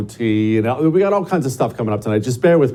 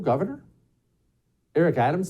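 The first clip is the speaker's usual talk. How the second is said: normal talking voice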